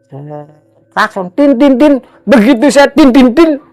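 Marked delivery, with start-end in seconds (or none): 1.4-2.0 high, loud "tin" beeps; imitated horn honks. Rider honking at a figure on the bridge, imitated horn. 2.9-3.6 high, loud "tin" beeps; imitated horn honks. Rider honking at a figure on the bridge, imitated horn.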